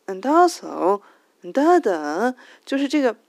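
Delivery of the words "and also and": "and also" loud, with a big swooping tune; rise-and-fall cadence. Shown as a demonstration. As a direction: stretch and swing the pitch on "and also". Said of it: "它的抑扬顿挫是这样".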